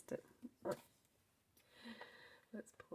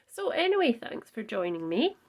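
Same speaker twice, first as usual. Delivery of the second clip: bright sign-off; 3 semitones higher, 11 dB louder than usual. Real, not acted. Wrapping up the video.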